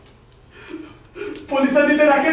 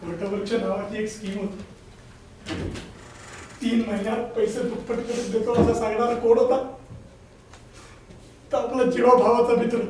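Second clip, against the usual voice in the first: quiet despair; 4 semitones lower, 8 dB quieter than usual. Acted. Ruined man confesses losing savings.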